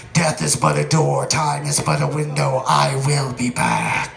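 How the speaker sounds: low menacing movie-villain growl; Vigo the Carpathian. Retold or imitated. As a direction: intone it like a trapped ancient tyrant. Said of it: "Vigo the Carpathian".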